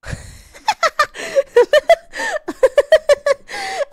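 high, loud cackling fit; hard laughter. Real, not acted. Streamer reacting to a clip, cracking up.

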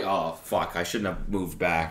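usual speaking voice

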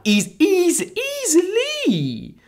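high, drawn-out fangirl squeal on easily; squealing excitement. Real, not acted. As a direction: stretch easily way up and slow, overjoyed. Hyping her favourite rapper.

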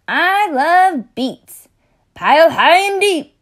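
high, loud, slowed-down gush over food; booming high character voice savoring each word; gushing party guest. Acted. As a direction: rave about the beets like a delighted guest. Character exclaims at a feast.

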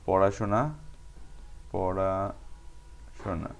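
word-by-word dictation. Shown as a demonstration. Teacher dictating the exercise to students, word by word with long gaps. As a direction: spell it out word by word, quietly, as if writing along.

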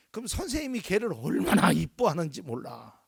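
ordinary talk

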